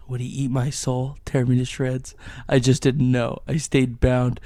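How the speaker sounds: loud, hammed-up erotic story read; overacted melodramatic narration; dramatic reading. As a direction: perform the fanfic over the top. Reads erotic fanfic aloud.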